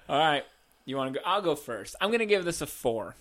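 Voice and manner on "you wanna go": usual speaking voice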